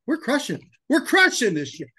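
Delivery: hyped declaration. Retold or imitated. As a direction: tell Tyler you're going to win. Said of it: "Looked at Tyler, said".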